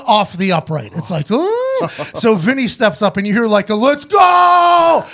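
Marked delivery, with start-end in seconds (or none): 1.3-1.9 high drawn-out ooh; crowd ooh. Retold or imitated. Team reacting to a kick off the upright, high ooh. 3.7-5.0 loud, high "let's go" yell; hype shout. Retold or imitated. Teammate yelling for the kicker, loud and high.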